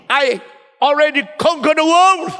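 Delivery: high, loud victor's proclamation; triumphant boast. Pretend. Emperor boasting of conquest, mock triumph.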